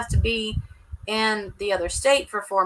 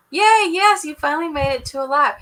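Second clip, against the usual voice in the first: high, loud cheer; excited cheer; 6 semitones higher, 8 dB louder than usual. Real, not acted. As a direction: greet them with a big cheer. Cheering a chat arrival.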